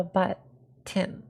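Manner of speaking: "but tin" split by a pause, T on "tin"; "but tin" with the T held back for "tin"; T stressed in tin. Shown as a demonstration. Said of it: "you emphasize the T in tin".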